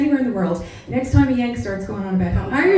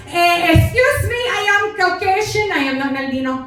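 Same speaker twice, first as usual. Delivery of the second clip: Mexican mother, indignant; 7 semitones higher than usual. Retold or imitated. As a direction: correct them indignantly in a Mexican accent.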